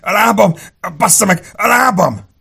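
high, loud cries of pain; agonized cursing. A narrator's reading. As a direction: cry out in agony from a gunshot leg. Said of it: "a sziszegő, káromkodó sebesültet". (fájdalmas, magas hangú jajgatás, káromkodás)

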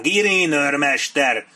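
long drawn-out loud yell; bellowed summons. A narrator's reading. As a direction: stretch the call out slowly, high and loud. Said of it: "üvöltötte ismét".

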